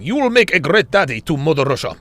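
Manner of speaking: light mock Russian accent reading the comment; a hint of a heavy Russian accent; playful Russian accent, lightly voiced. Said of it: "Strong Russian accent".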